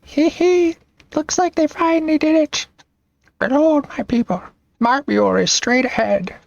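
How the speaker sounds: very high-pitched gleeful character; high squeaky voice. Acted. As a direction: giggle and go way up high. Giggling character announces arrival.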